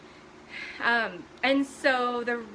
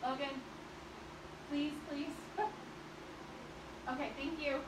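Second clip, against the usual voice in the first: quiet pleading; 5 semitones higher, 11 dB quieter than usual. Real, not acted. Quietly pleading with son nearby.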